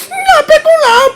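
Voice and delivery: put-on impatient mother correcting a child; scolding mother. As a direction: play the strict mother drilling her kid. Mother drilling child with a ruler.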